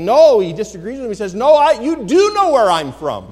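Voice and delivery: loud, high, emphatic preaching cry; strong emotion. Acted. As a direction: cry out the contradiction from the pulpit. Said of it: "Jesus spoke with strong emotion".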